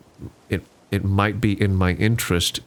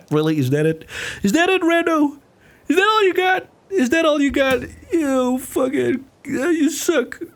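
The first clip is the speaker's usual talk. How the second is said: much higher, mocking heckler; squeaky taunting voice. Taunting himself as the chat.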